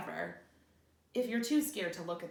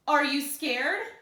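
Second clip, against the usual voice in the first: slow, loud, higher monster taunt; creature voice; 6 semitones higher, 9 dB louder, slower than usual. Acted. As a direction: menace the child slowly. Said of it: "the creature asked".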